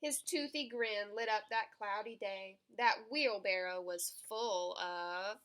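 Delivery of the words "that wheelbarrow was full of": plain reading voice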